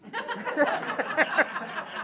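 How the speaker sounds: bright burst of high laughter. Real, not acted. Laughing at no more kids.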